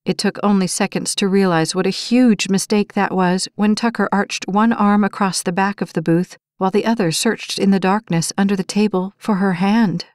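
ordinary delivery

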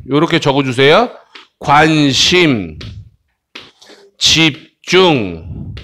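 normal talking voice

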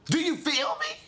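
squeaky high falsetto question. Acted. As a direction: jump way up into falsetto.

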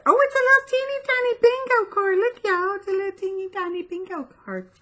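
high-pitched, racing excited coo; squealing over cuteness. Real, not acted. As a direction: squeak fast with delight at something tiny. Gushing over tiny bingo card.

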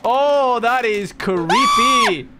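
loud, slow creeped-out shout; scared horror game streamer. Real, not acted. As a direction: yell it out, spooked. Ghost hunt in game.

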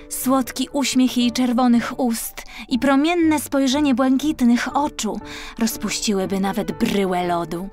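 usual speaking voice

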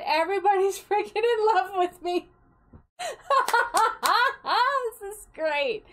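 high, loud, laughing squeal of delight; giddy laughing. Real, not acted. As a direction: gush through real laughter.

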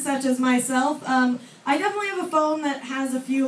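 normal talking voice

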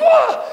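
very high, loud shriek; comic yell of shock; startled scream. Retold or imitated. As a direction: imitate a man jumping in fright. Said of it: "and he goes".

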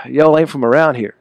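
quick drawled Southern accent. Shown as a demonstration. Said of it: "do your quick Southern accent real quick".

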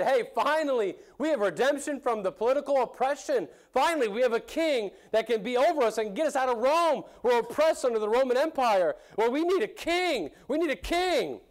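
a jubilant crowd demanding its king; eager crowd cheering. Retold or imitated. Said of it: "They said".

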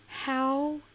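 slow, drawn-out mock outrage; exasperated. Real, not acted. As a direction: stretch each word in disbelief. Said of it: "I'm so mad right now".

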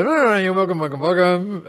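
lightly hammed-up greeter; welcoming host voice. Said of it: "So I go".

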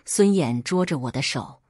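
plain reading voice

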